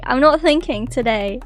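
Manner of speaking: usual speaking voice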